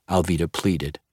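ordinary delivery, nothing marked

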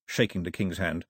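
ordinary delivery

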